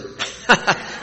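real high laugh at own joke. Real, not acted. Speaker joking to the audience about hometown, real amused laugh. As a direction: break into a short laugh.

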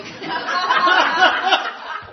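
loud high laughing burst; big rising laugh; burst of laughter. Real, not acted. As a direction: crack up at the memory. Laughing at own tangerine story.

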